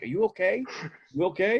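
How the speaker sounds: high imitated concerned voice; concerned bystander. Shopper checking on the creepy whisperer, mock concern.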